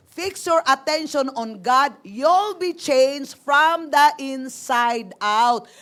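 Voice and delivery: slow, drawn-out reread of the verse; slow emphatic reread. Acted. Pastor rereading the key verse to the group, drawn out. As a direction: stretch out every word so it sinks in.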